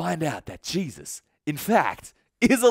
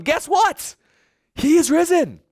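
high, loud excited announcement; 兴奋宣告; 10 semitones higher, 6 dB louder than usual. Acted. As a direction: burst out with joyful news. Women announce the news.

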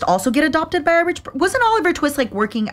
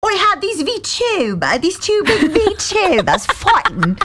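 mock quote; 8 semitones higher than usual. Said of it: "He's the one you were like".

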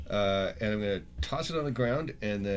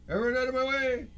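high-pitched, raised-voice warning shout; urgent yell; 4 dB louder than usual. Acted. Running for the exit with the bag, yelling. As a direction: yell to clear a path. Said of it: "just yelling".

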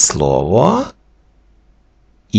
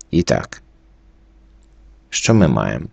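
ordinary talk

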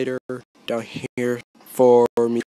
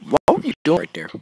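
fast, higher, louder complaint; frustrated outburst; 5 semitones higher, 4 dB louder, much faster than usual. Real, not acted. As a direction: snap at the teammate quickly. Teammate blunder in game.

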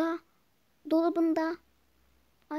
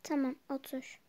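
ordinary talk

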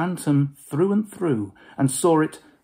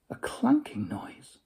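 whispered; 10 semitones higher, 5 dB quieter than usual. A narrator's reading. Ghostly narration, whispered.

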